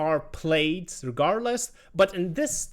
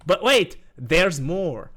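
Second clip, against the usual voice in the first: infomercial voice; 2 semitones higher, 5 dB louder, slower than usual. Pretend. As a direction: sell it like a late-night ad. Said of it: "Sounds like a bad infomercial".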